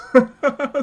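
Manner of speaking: a short, high, loud laugh. Real, not acted. Laughing at himself for drawing eight cards. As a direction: burst into a quick loud laugh.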